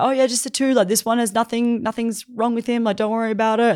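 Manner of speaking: high, falsely casual patter; covering up. Retold or imitated. Summoners lying to Rose, covering up in a high voice. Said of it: "They're trying to cover up".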